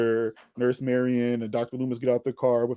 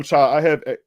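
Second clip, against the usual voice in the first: ordinary talk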